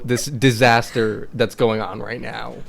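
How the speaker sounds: usual speaking voice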